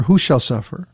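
ordinary talk, nothing marked

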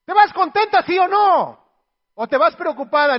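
high, loud playful calling; teasing call-out. Acted. Husband calls after his wife leaving to rest, loud and playful.

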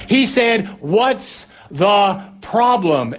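comic shouted repeat for someone who missed it; relayed yell. Acted. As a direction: pass the message on, word by word. Relaying yelled answer to other disciple.

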